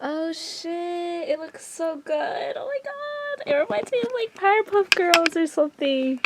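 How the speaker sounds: high, breathy delighted gush; excited squeal. Real, not acted. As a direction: squeal over something cute. Delighted by the finished heart.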